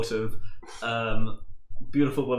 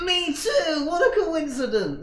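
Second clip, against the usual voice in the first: much higher eager nerd; high nerdy voice; slower than usual. Acted. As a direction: squeak it out like a keen nerd. NPC Milton answers investigators.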